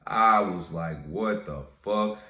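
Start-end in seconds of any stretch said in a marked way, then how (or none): 1.1-2.2 slow, louder, furious "What the fuck?"; angry disbelief. Retold or imitated. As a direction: drag out "What the fuck?" in outrage. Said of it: "I was mad as hell".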